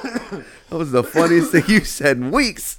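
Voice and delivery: slow words broken by laughter; laughing. Real, not acted. Co-host laughing, refusing to cut the joke. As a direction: talk through real laughter.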